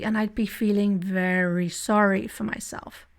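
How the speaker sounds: normal talking voice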